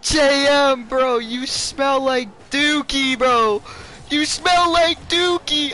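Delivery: slow, high-pitched teasing; high taunting. Pretend. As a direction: taunt him childishly.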